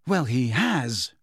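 slow and firm, a mild insistence. A narrator's reading. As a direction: set it down slowly, no arguing. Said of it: "said Hermione firmly".

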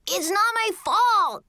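high, drawn-out whine of denial; child's shouted protest. A narrator's reading. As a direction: protest high like a blamed little kid. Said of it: "Kevin shouted again".

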